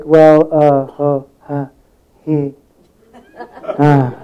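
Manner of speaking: stumped hesitation. Retold or imitated. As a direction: fumble for an answer you don't have. Retells being stumped for an answer.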